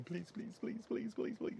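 soft begging whisper behind his back; pleading whisper. Retold or imitated. Cameraman silently begging the director to take the job, hushed pleading. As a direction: mouth please over and over, hushed.

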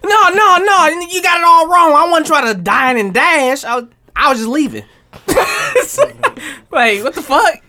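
high, loud, pleading fake innocence; mock excuse. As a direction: protest like a caught diner-and-dasher. Acting the arrested player's excuse.

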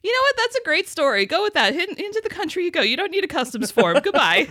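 high, chuckling officer voice waving her on; amused customs agent. Retold or imitated. Customs agent waving Kara into the country, amused high voice. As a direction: play the agent who just gives up and lets her in.

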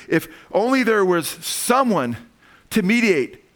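a touch of Job's longing cry; lightly voiced pleading Job. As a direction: beg for a mediator as Job. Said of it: "then he says".

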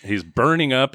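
ordinary talk

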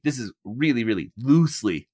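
heavy emphasis building to "loosely"; stress on LOOSELY. Real, not acted. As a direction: hit the word "loosely" hard. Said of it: "I really want to emphasize the word loosely".

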